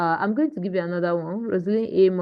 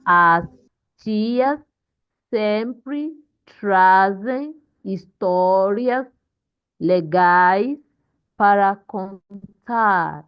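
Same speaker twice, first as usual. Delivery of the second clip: slow, separated words, stressed syllables drawn out; model reading; 3 semitones higher, 4 dB louder, much slower than usual. Shown as a demonstration. Teacher reading the text to class, slow and deliberate. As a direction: pause between words and hit each stressed syllable. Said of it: "That's how to read correctly in Portuguese".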